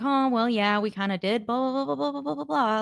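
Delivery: mumbling softly like a sulky postgame interview; mock soft-spoken mumble. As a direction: imitate a subdued player shrugging off questions. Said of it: "soft-spoken anyways, but a little bit more soft-spoken".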